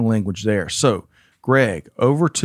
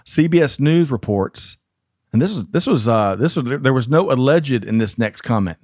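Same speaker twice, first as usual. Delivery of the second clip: ordinary talk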